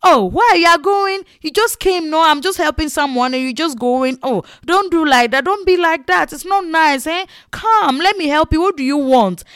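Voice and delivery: high, loud chiding seller; scolding. Retold or imitated. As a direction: scold her like a naughty child for leaving. Seller scolding customer for leaving.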